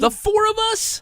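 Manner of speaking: high, hammed-up disbelief; pretending he never heard of the conference, squeaky; mock surprise. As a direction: play dumb, squeaking in shock.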